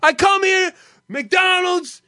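high, loud mock rant from a furious customer; angry customer. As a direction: shout the complaint like a fed-up customer demanding the manager. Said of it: "the customer would complain to a cashier".

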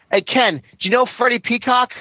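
ordinary delivery

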